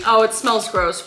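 normal talking voice

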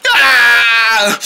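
long loud wail; acted bawling cry. Joe leaving the quarrel in tears, hammed-up wailing.

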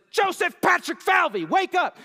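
high, loud, slow full-name shout to wake him; full-name yell. Retold or imitated. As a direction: bellow his full name like an exasperated parent. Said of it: "because I just yelled in there".

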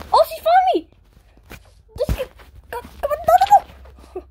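rising panicked protests; frantic, being caught; panicking gamer. Real, not acted. Found by opponent, panicking.

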